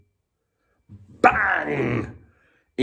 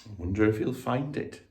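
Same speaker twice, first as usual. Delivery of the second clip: quiet aside; 9 dB quieter, faster than usual. Acted. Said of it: "aside to listeners".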